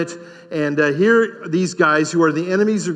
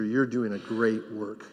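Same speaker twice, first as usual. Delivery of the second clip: much softer and lower, tender; soft aside; 8 semitones lower, 11 dB quieter than usual. Real, not acted. Pastor quietly affirming a teacher, soft and low. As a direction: tell the teacher gently, almost under the breath. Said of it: "repeated to teachers".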